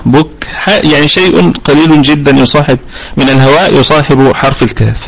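normal talking voice

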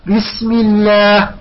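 "الله" with thin, front "llaah"; light lam. Shown as a demonstration. Teacher gives example after kasra, thin drawn-out "الله". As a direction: stretch "بسم الله" slowly with a light lam. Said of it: "لماذا رققنا اللام؟".

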